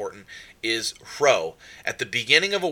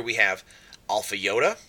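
usual speaking voice

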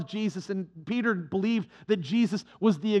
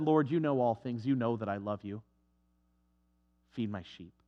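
hurt Peter; 10 semitones lower, 8 dB quieter than usual. Acted. Peter, hurt by the third question, answers softly. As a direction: lower and much softer, wounded.

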